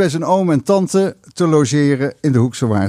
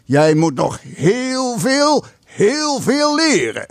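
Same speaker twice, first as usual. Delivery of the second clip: high, very slow stern lecture; stern uncle in clear Dutch; 9 semitones higher, much slower than usual. Acted. Uncle lecturing the boy in clear Dutch, sternly. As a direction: spell out each word sternly. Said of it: "in duidelijk verstaanbaar Nederlands".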